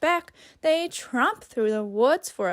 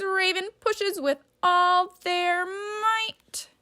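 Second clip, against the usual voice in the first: straining whisper; 5 semitones higher, slower than usual. A narrator's reading. Straining push described.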